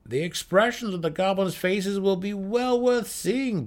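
high, amused, through a laugh. Acted. Gnome leader laughing at the drow's plan, high and amused. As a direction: savor the joke ahead. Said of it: "Brickers laughed".

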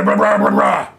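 low loud Batman mumble; mumbled gravel Batman. Retold or imitated. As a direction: growl the blah like Batman. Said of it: "Bale's Batman".

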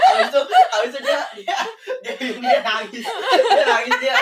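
high, helpless laughter through the words; laughing while talking. Real, not acted. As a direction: struggle to finish the story through laughter. Cracking up telling the prank. (bicara sambil tertawa geli)

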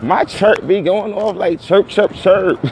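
high slow chirp chirp; imitated chirp. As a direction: mimic the walkie chirp. Imitating phone chirp sound.

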